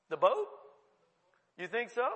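usual speaking voice

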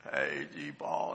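put-on a hoarse three-year-old voice, dropped very low and drawn out; froggy little boy. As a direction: croak it out low like a toddler with a sore throat. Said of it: "sounded like he had a little frog in his throat".

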